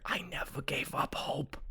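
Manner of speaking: hushed whisper in someone's ear; soft, voiceless, intimate reunion whisper. Acted. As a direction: whisper it as a private confession. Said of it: "he whispers in your ear".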